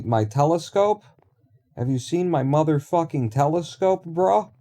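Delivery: chummy bro voice hollering hello to pals; goofy bro voice. Pretend. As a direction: play a hyped-up bro calling to his buddies. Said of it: "I don't know why I'm talking like this".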